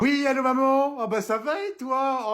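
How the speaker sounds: high, bright, sugary hello to mum; sugary phone voice. Acted. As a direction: sing it sweetly into the phone. Sugary phone call to mother. (voix sucrée au téléphone pour maman)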